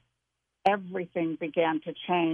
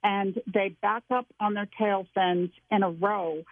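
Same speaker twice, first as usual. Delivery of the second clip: ordinary delivery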